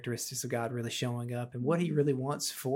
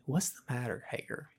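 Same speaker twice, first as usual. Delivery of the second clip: soft, tender divine question; quietly caring, as God to someone in distress; gentle; 9 dB quieter than usual. Acted. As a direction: ask it gently, much softer. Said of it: "I think it's gentle".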